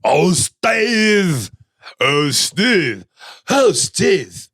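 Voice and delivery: high, drawn-out caricature wail; each in a different exaggerated character voice; passengers in chorus. Passengers all groan at the driver, hammed-up voices. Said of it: "they all say at the same time".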